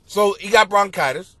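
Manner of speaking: usual speaking voice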